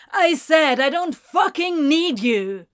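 loud furious shout. A narrator's reading. Anthony shouting at Ezra while walking off. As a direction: hurl it at him. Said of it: "Anthony shouted".

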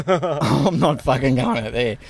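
laughing it off while refusing; a real laugh running through the refusal. Real, not acted. Refusing a huge wave, laughing.

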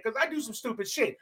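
usual speaking voice

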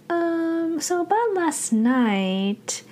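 high, slow, hesitant opener; sheepishly dragging out an awkward excuse; a guilty girl edging into an apology; guilty hesitation. Retold or imitated. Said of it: "she feels kinda guilty".

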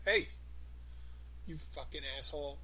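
mean, cutting insult; hostile insult. Retold or imitated. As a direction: sneer the insult with malice. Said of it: "like that".

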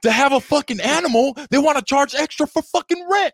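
high, loud exasperated yell; furious rant. Real, not acted. Ranting to friends about pet rent, high loud furious yell. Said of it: "this is the angriest I've ever seen you".

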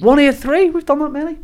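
high astonished disbelief; high-pitched shock at the count; surprised. Real, not acted. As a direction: react like you can't believe the number. Host shocked at episode count.

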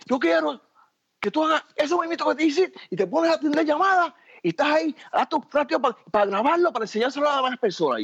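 voz imitada, mucho más aguda; da órdenes corridas en tono alto; jefe dando instrucciones. Retold or imitated. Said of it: "la cuestión es que me dice". (imitando al productor entusiasmado con su idea)

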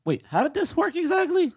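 high, baffled double take; high baffled double take at the poster. Retold or imitated. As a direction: ask it high, in disbelief. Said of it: "I'm like".

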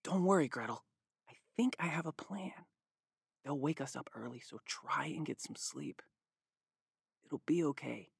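calm his little sister down gently; softly reassuring boy. Acted. Hansel comforts Gretel at night.

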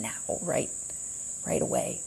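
urgent whispered plea; whispering. Retold or imitated. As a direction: whisper it as a warning. Quoting people calling for attention.

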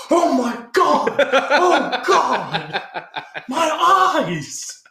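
very high, loud, drawn-out wailing; hammed-up cries of a scalded victim; mock agonized scream.

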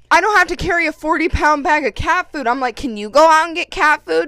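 loud, heated retelling of the fight; loud indignant comeback to the sister; retold argument.

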